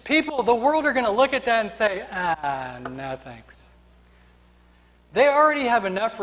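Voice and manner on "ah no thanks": low, quiet brush-off; dismissive refusal. Acted. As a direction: voice an onlooker politely backing away. The world turns down faith.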